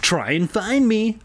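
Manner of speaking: high, drawn-out taunt; teasing. A narrator's reading. Older brother hiding from his brother, high slow tease. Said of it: "I teased".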